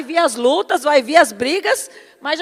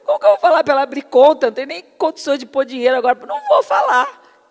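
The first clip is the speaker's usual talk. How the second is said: fast words broken by laughter, talking back to God; laughing disbelief; faster than usual. Retold or imitated. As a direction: laugh through the protest like Sara did. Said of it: "Eu comecei a rir".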